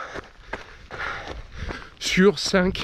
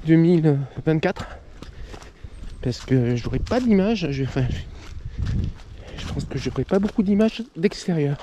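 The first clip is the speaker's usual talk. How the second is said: normal talking voice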